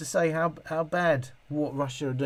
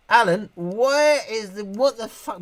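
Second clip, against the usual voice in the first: startled outburst; 8 semitones higher, 5 dB louder, slower than usual. Real, not acted. Shocked outburst at chat.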